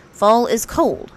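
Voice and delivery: stern warning; Rabbit voice. A narrator's reading. Rabbit warning Pooh to bundle up, slow and stern. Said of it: "said Rabbit".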